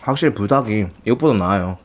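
talking with mouth full; speaking while eating; chewing noodles. Real, not acted. Seen 0: Slurps noodles until -1s; cheeks full, mouth working while speaking.

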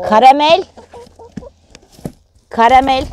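high, loud fond call; calling fondly. Real, not acted. Calling her favourite hen Karamel, high and loud. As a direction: call the hen by name, lovingly.

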